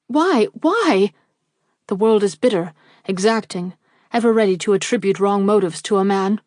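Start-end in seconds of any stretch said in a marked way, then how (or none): 0.1-1.1 desperate cry. A narrator's reading. Desperate protest to refusal.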